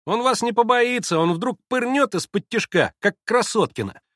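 put-on high, loud boyish warning; shouted warning. A narrator's reading. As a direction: warn him urgently like a boy. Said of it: "кричали ему вслед предупредительно". (предостерегающий крик мальчишки)

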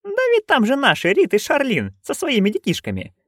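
high, gleeful explaining; bubbly and high; excited. A narrator's reading. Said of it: "объяснила нянюшка".

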